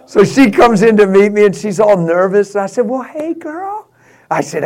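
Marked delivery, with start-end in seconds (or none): 2.9-3.9 higher, softer, slow friendly hello; gentle greeting. Retold or imitated. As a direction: greet her kindly to put her at ease. Greeting nervous girlfriend warmly.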